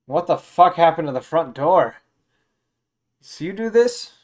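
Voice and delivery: loud, high-pitched, outraged accusation; angry outburst. Acted. Guy finds his front door smashed, angrily accusing. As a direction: demand answers, voice climbing at the end. Guard confronts intruder over broken door.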